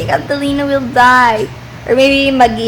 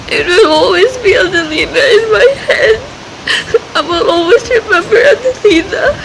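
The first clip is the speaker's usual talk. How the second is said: heartbroken over the character's fate, crying openly; real crying; 8 semitones higher than usual. Real, not acted. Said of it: "I'm crying again".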